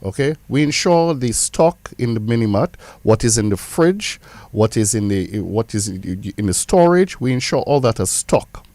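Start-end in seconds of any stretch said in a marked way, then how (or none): none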